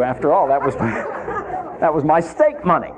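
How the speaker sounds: breaking into laughter mid-complaint; laughing. Real, not acted. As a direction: laugh at yourself over the lost steak money. Laughing at his lost steak money.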